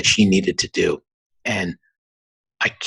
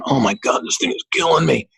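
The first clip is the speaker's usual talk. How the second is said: mock complaint; 7 semitones higher than usual. Complaining about his head at home.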